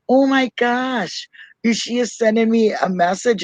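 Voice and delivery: high, drawn-out "oh my gosh"; re-enacted overwhelmed wonder; awestruck. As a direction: gasp out starstruck disbelief. Awed at first voice message.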